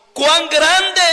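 loud, high exclamation; exclaimed. Shown as a demonstration. Preacher reading the psalm to the church, exclaimed. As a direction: read it with the exclamation mark. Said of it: "signo de admiración".

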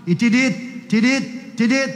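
quick spoken beeps; hurried heart-monitor tidit. Retold or imitated. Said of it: "empieza a ser más rápido".

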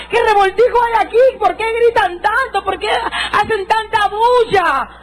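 high, ringing preacher's cry; impassioned testimony. Retold or imitated. Woman preacher retelling shouts at a revival, impassioned high cry. Testimony climax, cries and confusion retold.

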